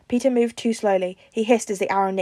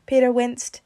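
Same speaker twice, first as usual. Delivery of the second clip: plain reading voice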